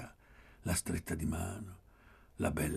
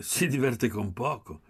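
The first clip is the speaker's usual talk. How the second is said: raised, higher and louder retort; forte; 5 semitones higher, 6 dB louder than usual. A narrator's reading. Pieretto cutting in on Poli, loudly. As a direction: throw it out loud and pointed. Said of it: "Pieretto disse forte".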